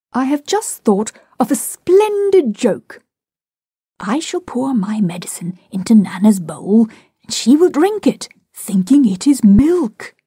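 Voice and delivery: put-on high, lively sales pitch to children; coaxing enthusiasm. A narrator's reading. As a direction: sell the joke eagerly, voice lifting. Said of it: "he said entreatingly".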